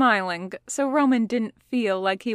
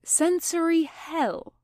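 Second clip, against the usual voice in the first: higher, slow, ironic air-quote voice; mock quoting; 8 semitones higher, slower than usual. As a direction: put the words in scare quotes. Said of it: "made air quotes".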